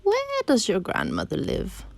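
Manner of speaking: high, slow, sliding wolf voice; story wolf. Acted. Wolf asks the girl for directions, slow high coaxing. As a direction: probe slowly, starting high.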